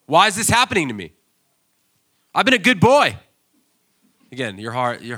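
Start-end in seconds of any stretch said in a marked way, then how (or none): 0.0-3.2 high, loud whiny protest; whiny complaint. Retold or imitated. Preacher mimics a believer griping at God, whining. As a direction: whine like a child who feels cheated. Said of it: "'cause you think".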